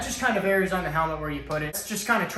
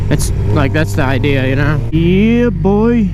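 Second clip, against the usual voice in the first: inside a helmet; 14 dB louder than usual. Real, not acted. Riding moto vlog in helmet mic.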